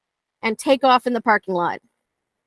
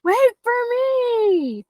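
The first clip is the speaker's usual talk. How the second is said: very high, slow, pleading little voice; dog's voice; 14 semitones higher, much slower than usual. Retold or imitated. Trainer voicing the dog chasing after her, high and slow. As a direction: squeak it out like a dog left behind. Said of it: "And they're like".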